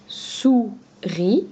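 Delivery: "Souris" in two slow, separated syllables, rising at the end; teacher's clear break between the syllables of "Souris"; split into syllables. Shown as a demonstration. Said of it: "on en enlève sou, il reste ri". (« Souris » bien découpé en deux syllabes)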